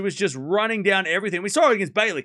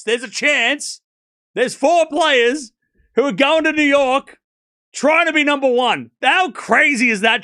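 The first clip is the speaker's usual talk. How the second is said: play-by-play commentary; 7 semitones higher, 7 dB louder than usual. Real, not acted.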